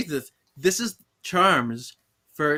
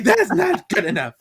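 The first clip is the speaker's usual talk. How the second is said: high, loud mock retort; hammed-up scolding; mock indignation; 10 semitones higher, 7 dB louder than usual. [name] rejecting Henry's answer, loud and high.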